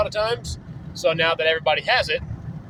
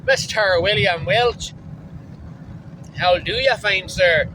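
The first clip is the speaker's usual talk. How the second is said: mock Irish accent; 2 semitones higher, slower than usual. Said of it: "say it in, like, a Scottish accent or, like, an Irish accent".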